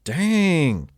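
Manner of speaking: a sudden high, loud "Dang!"; surprised exclamation. Real, not acted. Host reacting to the cruel obituary, surprised. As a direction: react like you can't believe it.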